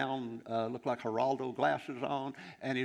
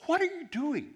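very high, incredulous retold question; baffled question; 4 dB louder than usual. Preacher asking Bob about his odd gesture, baffled. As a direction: pitch it way up in disbelief.

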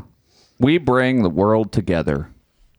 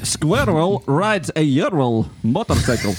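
put-on heavy Russian accent; 4 semitones higher, slower than usual. Comedy bit in a Russian voice.

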